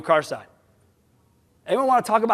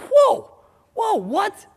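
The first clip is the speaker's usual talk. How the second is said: shocked whoa; 12 semitones higher, much slower than usual. Acted. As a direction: jump up in mock shock. Reacts to colleague's doubt.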